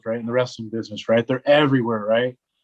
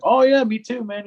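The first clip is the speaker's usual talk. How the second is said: high, loud, excited fellow-fan voice; eager agreement; 7 semitones higher, 5 dB louder than usual. Retold or imitated. Stranger finding a fellow wrestling fan at the gym, eager.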